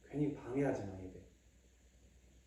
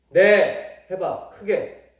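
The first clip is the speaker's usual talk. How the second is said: loud demand; 4 semitones higher, 14 dB louder, much slower than usual. Real, not acted. Parent demanding a clear answer.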